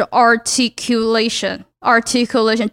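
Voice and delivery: "articulation" carefully sounded out, each syllable distinct. Shown as a demonstration. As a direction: demonstrate clear diction on "articulation", syllable after syllable. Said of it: "就一个字，一个字，一个字". (把articulation一个音节一个音节地念清楚)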